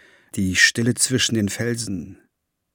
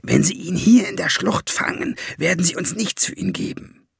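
higher, urgent hissed argument; conniving dwarf in a raised voice; 7 semitones higher than usual. A narrator's reading. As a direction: press the scheme on him. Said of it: "zischte Valiant ihm zu".

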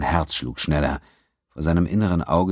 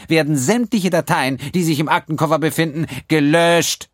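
high, loud, superior; prim accountant; 12 semitones higher, 5 dB louder than usual. A narrator's reading. The accountant answering Quinn, high and superior. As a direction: correct him coldly.

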